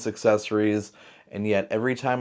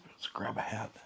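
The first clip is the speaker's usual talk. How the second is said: whisper; 14 dB quieter than usual. Real, not acted. Aside while adjusting setup.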